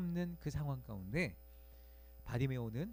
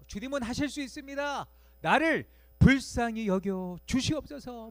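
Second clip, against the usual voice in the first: pleading prayer; 6 semitones higher, 8 dB louder than usual. Pretend. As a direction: beg the Lord with pride set aside. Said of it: "모든 자존심을 내려놓고". (간절히 애원하는 기도)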